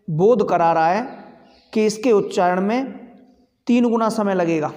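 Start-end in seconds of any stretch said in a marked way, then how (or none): none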